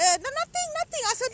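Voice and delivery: high, loud flustered denial; caught out. Acted. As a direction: squeak it out like you've been caught. Caught with the phone by sister-in-law.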